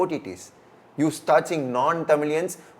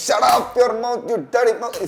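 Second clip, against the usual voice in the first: higher, louder mock comic scolding; Goundamani catchphrase; 8 semitones higher, 4 dB louder than usual. Guest quoting a Tamil comedian's line, mild mock scold. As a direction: toss it off like a film comedian's put-down, lightly hammed. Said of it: "a Goundamani saying".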